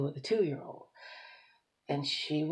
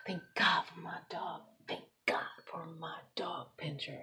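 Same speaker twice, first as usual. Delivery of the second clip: hushed grateful whisper; 2 semitones lower, 10 dB quieter than usual. Real, not acted. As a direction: breathe the thanks out softly. Whispered thanks for lost dog.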